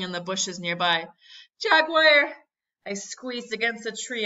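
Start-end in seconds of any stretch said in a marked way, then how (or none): 1.6-2.3 high, loud shout of alarm; alarmed cry. A narrator's reading. Boy hearing rustling bushes, crying out in alarm. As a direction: cry out as if danger is near. Boy fears a jaguar nearby.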